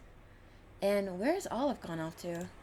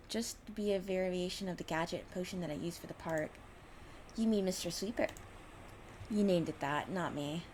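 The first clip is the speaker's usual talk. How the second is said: ordinary delivery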